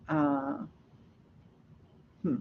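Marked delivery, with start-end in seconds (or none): none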